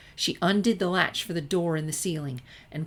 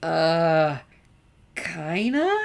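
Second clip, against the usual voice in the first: awkward surprise; 7 dB louder, much slower than usual. Acted. Sunset caught off guard, nose to nose.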